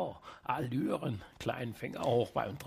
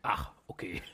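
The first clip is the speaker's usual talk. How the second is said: deep voice; 8 semitones lower than usual. Pretend. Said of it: "Der Superheld mit der tiefen Stimme".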